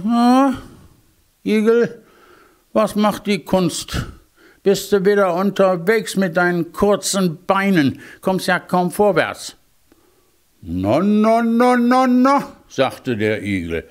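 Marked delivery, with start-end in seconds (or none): 0.0-9.5 high, cocky voice of a boastful hare; arrogant hare. Acted. Hare teases the hedgehog about his short legs, high and cocky. 10.6-12.5 rising, higher and higher na na na; high protesting hedgehog. Acted. Hedgehog rejects the hare's mockery, high and rising.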